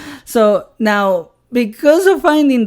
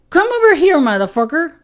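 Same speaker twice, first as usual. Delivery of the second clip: retold shout-out; 8 semitones higher, faster than usual. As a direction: yell it to a friend as a joke. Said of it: "I was like".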